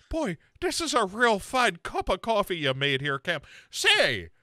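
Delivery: high, folksy mock compliment; butter him up in a high voice; mock Sarah. Friend mocking Sarah praising the coffee, high and folksy. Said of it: "she's like".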